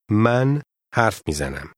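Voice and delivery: usual speaking voice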